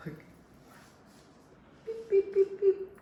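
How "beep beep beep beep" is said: very high sing-song beeps; high bright beep-beep game; playful toy beeps. Pretend. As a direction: chirp it like a toy for a baby. Toy noise to entertain toddler.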